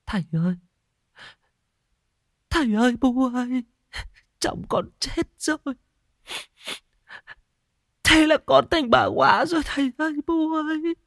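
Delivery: put-on very high, choked crying girl's voice; sobbing young widow. A narrator's reading. Widowed daughter cries to her father, high sobbing wail. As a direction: wail it out through sobs. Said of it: "cô chỉ òa khóc nghẹn ngào".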